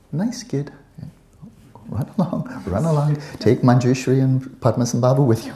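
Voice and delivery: high-pitched, patronizing brush-off; mock dismissive. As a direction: humour a child while shooing him away. Lamas brushing off the child visionary.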